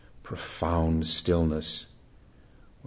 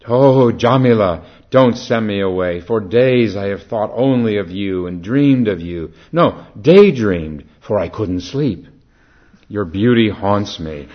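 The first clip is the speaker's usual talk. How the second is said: higher, louder begging; desperate plea; 4 semitones higher, 11 dB louder than usual. Acted. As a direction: plead with everything you have. Lover begging at the door.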